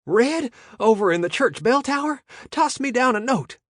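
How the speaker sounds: high, loud breathless panic; panicked. A narrator's reading. Lookout warning the gang of police, high panicked voice. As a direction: rush the news out in a panic. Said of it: "In a panicked voice".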